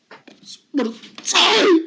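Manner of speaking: high, crying repeat; crying wail. Acted. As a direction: let the despair break out in a sob.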